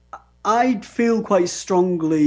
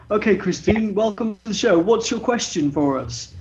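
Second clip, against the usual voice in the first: usual speaking voice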